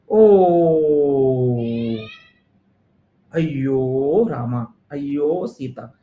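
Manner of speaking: slow, low wailing lament; mock despair. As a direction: groan it out, defeated. Student despairs after realizing.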